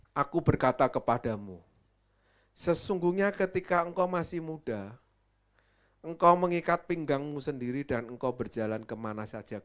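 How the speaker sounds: loud proclamation. Acted. Preacher voicing Jesus's words.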